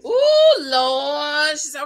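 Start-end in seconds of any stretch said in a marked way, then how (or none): 0.1-0.6 long, high, loud "ooh"; scandalized "ooh". Real, not acted. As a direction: whoop at the drama, high and loud. Reacting to the argument.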